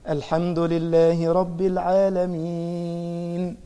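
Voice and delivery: slow, pausing recitation of the verse; slow measured recitation. Shown as a demonstration. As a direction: read it slowly and stop at the verse end. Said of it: "وقف هنا". (تلاوة بطيئة مع الوقوف)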